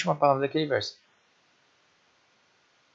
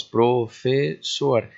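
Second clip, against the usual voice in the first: syllable by syllable; 4 dB louder, much slower than usual. Shown as a demonstration. Teacher showing the class syllable division, syllable by syllable. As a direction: slowly split into three syllables. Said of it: "Nós temos três sílabas".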